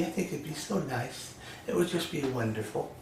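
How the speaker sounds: very soft, tender musing; mock dreamy wish. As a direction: imitate a mother softly longing for a baby. Voices a mother wishing another baby.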